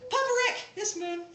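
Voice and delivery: put-on high, earnest child voice; serious little boy. Nephew shows Papa Rick the sign for moon, serious child voice. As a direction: imitate a small boy proudly showing grandpa. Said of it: "He's all serious".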